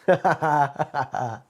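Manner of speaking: a short burst of real laughter; amused laugh. Real, not acted. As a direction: laugh at your own joke. Laughs at own toilet joke.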